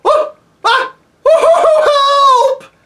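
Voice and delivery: gasp, then very high yell for help; startled scream. A narrator's reading. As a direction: shriek in sudden panic. Bree startled by the lion.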